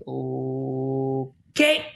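long drawn-out, hesitant okay rising at the end; retold uneasy acceptance; awkward okay. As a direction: stretch it out, unsure how to react. Awkward reaction to her sex answer.

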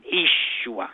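"Yeshua" opening on a long EE, EE-shoo-ah; double E sound. Shown as a demonstration. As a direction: say "Yeshua" with no Y, just a long ee first. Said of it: "Y is really a double E sound".

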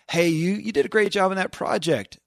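high, bright imitation of someone handing out praise; mock coworker praise. Host mimics a colleague praising a project, voice pitched high.

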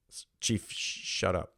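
hesitant stammered whisper, almost voiceless; nervous whisper. Retold or imitated. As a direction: stammer it out under your breath, scared to say it. Nervously telling chief shut up.

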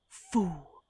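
ordinary delivery, nothing marked

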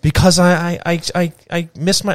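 stammering after losing the place in the script; fumbling for words with repeated I's; lost place. Real, not acted. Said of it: "I lost my place".

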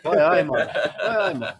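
Northern lad hamming his Geordie; Geordie accent. Acted. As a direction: lay on his own Geordie. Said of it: "[name] turns up".

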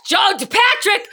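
drawn-out, high, loud outrage; scandalized shout. Real, not acted. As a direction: call him out by full name. Outraged at crude joke.

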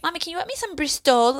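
put-on light kid voice asking; child voice. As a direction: ask like a little girl.

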